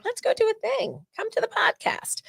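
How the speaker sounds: very high, unvoiced whisper of an invitation; playful whispered invite. Inviting Perry to the podcast, a high playful whisper.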